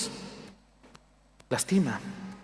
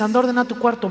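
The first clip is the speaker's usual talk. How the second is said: raised, higher mock scolding; higher, louder voice of a fed-up parent giving orders; parent and sulky teen; 6 semitones higher, 3 dB louder, faster than usual. Voicing annoyed parent and sulky teen.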